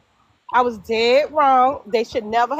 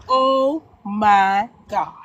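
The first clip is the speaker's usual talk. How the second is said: stunned; 3 semitones lower, much slower than usual. Retold or imitated. Sees groom marrying another woman.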